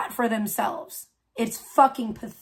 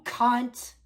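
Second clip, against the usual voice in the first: usual speaking voice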